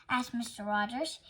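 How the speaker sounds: usual speaking voice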